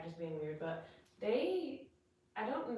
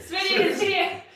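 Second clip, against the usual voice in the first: high, loud and slow; excited announcement; 10 semitones higher, 12 dB louder, slower than usual. Real, not acted. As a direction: call it out with delight. Greeting the dog excitedly.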